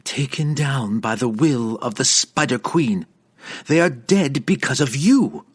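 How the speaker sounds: rising angry accusation; accusing cry. Acted. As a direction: hurl the blame at him. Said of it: "she cried".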